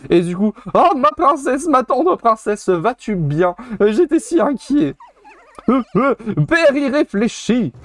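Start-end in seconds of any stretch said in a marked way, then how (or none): 0.7-4.9 high, pleading voice of a doting monkey king; worried king. Acted. Voicing King Mojo rejoicing over his princess, high and anxious. 5.7-7.7 a hint of a scolding princess, high and slow with a laugh; lightly voiced princess. The princess chiding her rash father, mock-stern and high.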